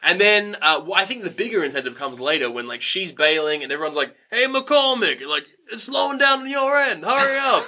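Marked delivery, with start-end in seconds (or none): 4.3-7.7 high-pitched call across a workplace. Acted. Mill workers to a slacking colleague, high impatient call. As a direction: holler it lightly, as if to someone far off. Voices workers calling to Ren.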